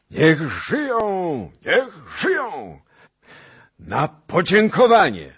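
very high, slow, drawn-out shouted cheer; cheering toast. A narrator's reading. Skrzetuski thanking the prince, shouting cheers. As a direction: roar the cheer across the hall. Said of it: "wołał Skrzetuski".

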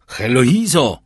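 loud call through a door. A narrator's reading. Husband calling his wife through the locked door, loud call. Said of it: "zawołał".

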